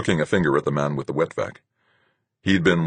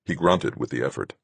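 ordinary delivery, nothing marked

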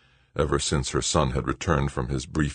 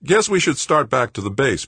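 ordinary delivery

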